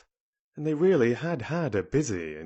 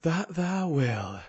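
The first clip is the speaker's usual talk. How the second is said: drawn-out, higher Yorkshire lilt; slow Yorkshire assurance, pitched up; Yorkshire country boy; 6 semitones higher, slower than usual. A narrator's reading. Dickon encouraging Colin, Yorkshire country boy.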